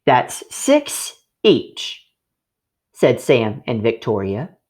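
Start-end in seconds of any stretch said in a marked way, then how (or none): 0.0-2.1 put-on high, slow child voice counting it out; childlike, slowly sharing out; slow careful counting. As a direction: spell out the sum, drawn out. Children dividing cookies slowly.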